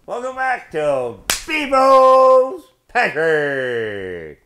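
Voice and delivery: big drawn-out hollered welcome; loud, high, slow announcer-style yell; shouted show intro. Real, not acted. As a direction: holler the show name, stretching each word. Show opener called out to viewers.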